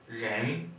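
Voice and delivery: ren steigend im zweiten Ton; second tone. Shown as a demonstration. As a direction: ren nach oben ziehen. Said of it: "Second tone".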